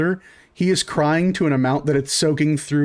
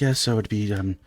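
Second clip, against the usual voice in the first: a lower, softer, timid waiter voice; lower quiet waiter; 5 semitones lower, 5 dB quieter than usual. Acted. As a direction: mumble the total like a shy waiter. GM voices the waiter quoting total.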